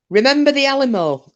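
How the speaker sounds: a hint of a Texan rallying cry, pitched high; mock battle cry with Remember lifted high; lightly voiced Texan; joke Texan. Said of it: "Texan said".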